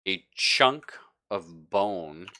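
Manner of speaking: normal talking voice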